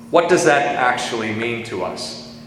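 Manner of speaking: normal talking voice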